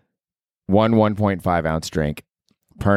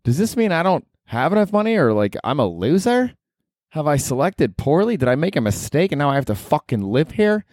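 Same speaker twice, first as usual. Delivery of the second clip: anxious inner voice; 7 semitones higher than usual. Retold or imitated. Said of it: "you're like".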